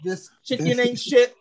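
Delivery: mock a furious celebrity chef, pitch way up; cursing out the cook's chicken in a high mock rant; Gordon Ramsay yelling. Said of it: "He be like".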